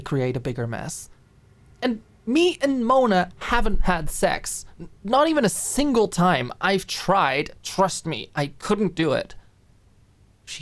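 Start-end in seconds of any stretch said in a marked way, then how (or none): 1.8-9.3 lightly voiced high flustered admission; flustered inner voice. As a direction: blurt it out, a touch embarrassed. Alicia's inner flustered thoughts.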